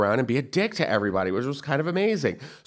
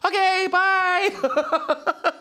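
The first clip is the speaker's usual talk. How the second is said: very high, very slow drawn-out bye; embarrassed exit; much slower than usual. Retold or imitated. Fan retreating from Billy Zane, high slow awkward bye. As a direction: squeak out an awkward goodbye. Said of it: "awkward exit".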